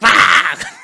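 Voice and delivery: sudden loud yell; jump-scare shout. Retold or imitated. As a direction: burst out with a startled shout. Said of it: "turns around and he's like".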